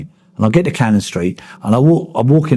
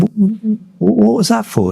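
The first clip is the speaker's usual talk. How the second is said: much higher, stammering in disbelief; retold shock; 13 semitones higher than usual. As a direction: stutter out the question, stunned. Mate knocks a man out.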